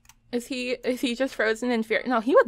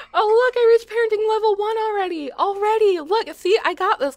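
very high, loud gleeful squeal; loud, high-pitched bragging; excited squeal; 12 semitones higher, 7 dB louder than usual. Real, not acted. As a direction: squeal in triumph, voice way up. Celebrates reaching parenting level one.

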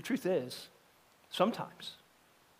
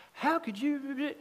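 indignant disbelief; 9 semitones higher, much slower than usual. Retold or imitated. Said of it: "where you're like".

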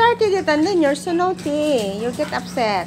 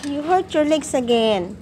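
ordinary delivery

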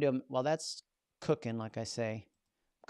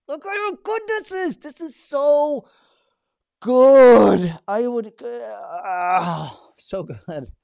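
much higher, loud, stretched "so good"; delighted; 12 semitones higher, 17 dB louder, much slower than usual. Real, not acted. Host amazed at the AI's script, gushing. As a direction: gush over it. Marvelling at the output.

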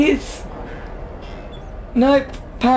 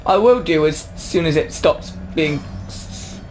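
ordinary talk, nothing marked

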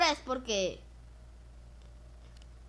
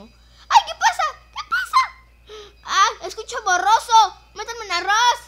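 very high shrieking alarm; 10 semitones higher, 11 dB louder than usual. Real, not acted. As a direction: shriek in confusion. Dazed in game.

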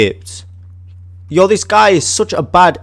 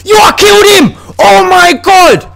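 much higher, loud victory yell; triumphant shout; 5 dB louder than usual. Real, not acted. Gamer celebrating his first kill, high loud shout.

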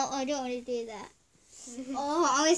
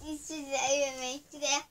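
ordinary talk, nothing marked

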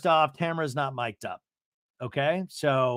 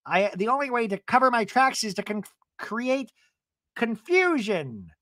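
lightly hammed-up plotting, raised pitch; mock scheming traitor; 8 semitones higher than usual. Retelling the traitor's plan, mock scheming. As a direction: draw out the last word with relish.

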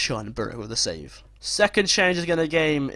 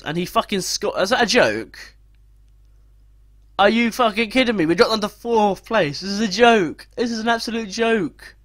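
high, loud, incredulous outburst; furious, raised-pitch protest; real anger; 8 semitones higher, 5 dB louder than usual. Real, not acted. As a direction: rage in disbelief at the game. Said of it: "which I'm really angry about".

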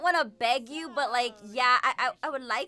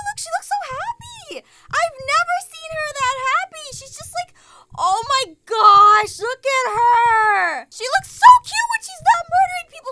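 high, loud fangirl squealing; squealed, lovestruck excitement; gushing squeal; 9 semitones higher, 11 dB louder than usual. Real, not acted. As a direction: gush in delight, drawing out the big exclamations. Gushing over lovestruck game character.

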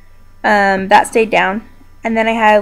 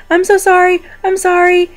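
retold, higher frantic apologizing; imitating shocked apology; 8 semitones higher than usual. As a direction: replay your dazed self saying sorry, pitched up. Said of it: "in shock, the only thing I could think to do".

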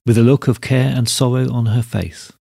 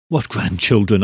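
ordinary delivery, nothing marked

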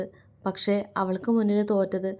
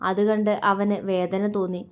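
usual speaking voice

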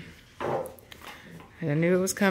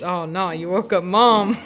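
louder mock panic through a laugh; laughing mock alarm; 7 dB louder than usual. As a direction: laugh as you say it, teasing.

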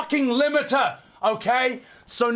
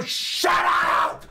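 mock cry-shout. Ending defensive rant.

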